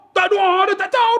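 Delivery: high-pitched comic protest; flustered retold answer. Pilgrim admits to Ustaz he prayed nothing, high comic protest. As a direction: blurt it high, imitating the pilgrim.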